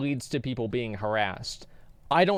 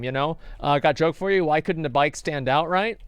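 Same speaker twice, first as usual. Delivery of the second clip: normal talking voice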